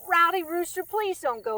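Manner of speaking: calling out. A narrator's reading. Little frog calls after the departing rooster, loud and high. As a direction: cry out to the rooster not to leave. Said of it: "Little Bug Eyes cried".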